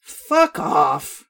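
put-on loud drunken holler. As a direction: bellow it away. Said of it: "she hollers".

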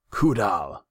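loud, high call of a name. A narrator's reading. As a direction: call for the one you need. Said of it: "he said".

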